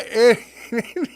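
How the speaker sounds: real laugh breaking into a cough; laughing fit. Real, not acted. Laughing at his own joke to viewers, a real laughing fit. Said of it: "make myself laugh".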